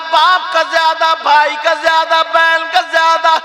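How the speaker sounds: very high, loud, drawn-out declamation; full-force preaching. Real, not acted. Preacher proclaiming Islam's ruling to the sisters, full-force preaching. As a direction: proclaim it slowly at a shout, stretching the words.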